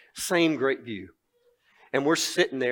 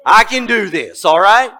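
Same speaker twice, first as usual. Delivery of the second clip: high, loud, whooping glee; giddy cheer; 10 semitones higher, 17 dB louder, slower than usual. Retold or imitated. Preacher voicing the money-givers, high loud whooping cheer. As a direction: shout it like you just got paid. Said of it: "and they're going".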